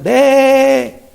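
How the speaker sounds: high, loud, drawn-out baa; imitated sheep bleat. Sheep answering their shepherd, loud imitated bleat. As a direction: bleat back like an answering flock. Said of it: "las ovejas empiezan".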